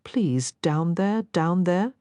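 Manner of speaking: slow, pleading rising tone; begging. Acted. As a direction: plead for it, slowly. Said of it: "her entire body begging".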